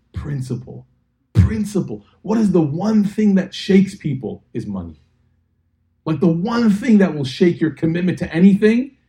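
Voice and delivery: high, loud preacher's build; fiery sermon peak; preaching at full force. Acted.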